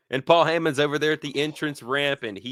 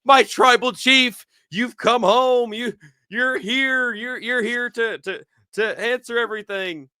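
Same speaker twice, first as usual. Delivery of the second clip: mock gushing welcome; 11 semitones higher, 4 dB louder than usual.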